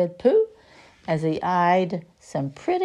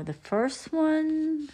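high, slow drawn-out start; slow high storyteller hello; sing-song opener; 11 semitones higher, much slower than usual. Acted. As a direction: stretch it out high like greeting kids.

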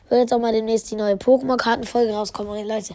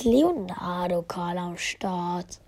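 whispering; 5 semitones lower, 7 dB quieter, much slower than usual. Real, not acted. Sudden whisper at a rare pull.

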